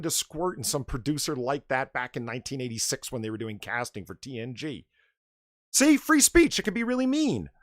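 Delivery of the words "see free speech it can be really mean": sarcastic outburst. Real, not acted. Loud sarcastic punchline.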